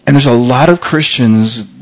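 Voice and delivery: ordinary talk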